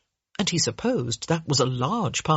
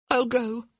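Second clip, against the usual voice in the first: voice breaking; 6 semitones higher than usual. Acted. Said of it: "her voice breaking".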